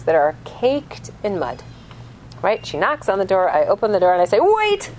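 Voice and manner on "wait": high, loud cry to stop her; mother shouting at a muddy seven-year-old; sharp shout. Retold or imitated. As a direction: yell to halt the child at the door. Mother stops muddy child at door.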